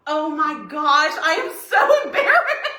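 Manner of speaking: embarrassed laughing. Retold or imitated. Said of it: "I was like".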